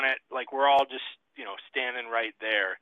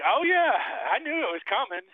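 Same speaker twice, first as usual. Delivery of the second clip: amused officer; 12 semitones higher, 4 dB louder than usual. Retold or imitated. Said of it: "he's laughing".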